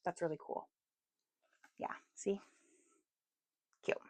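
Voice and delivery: soft whisper showing camera. Real, not acted. Whispering while showing page.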